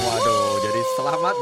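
high excited cheer; hyped giveaway announcement. Acted. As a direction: cheer the winner loudly. Celebrating winner, very high.

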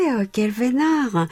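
ordinary talk

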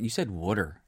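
water said as "wooder"; Jersey water. Retold or imitated. Friend quoting back the word, water as "wooder". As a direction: imitate the Jersey vowel in water. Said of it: "it's spelled W O O D E R".

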